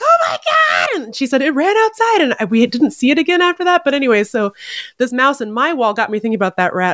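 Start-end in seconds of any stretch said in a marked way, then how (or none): 0.0-1.0 startled high yell; yelling in shock at the rat; shout. Retold or imitated. Roommates yelling in alarm at the screaming girl. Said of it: "we were like".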